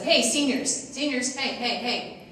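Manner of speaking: re-enacted call across the bleachers. Parent calling to rowdy seniors in the bleachers, firm hail.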